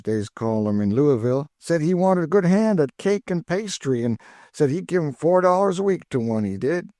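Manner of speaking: put-on high, eager old cook's voice; high cheerful Chloe, teasing her missus; Aunt Chloe. A narrator's reading.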